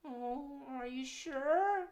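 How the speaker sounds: high, slow, timid critter voice; shy little muskrat voice, drawn out; high timid puppet muskrat; nervous puppet. Acted. Puppet Gus speaks.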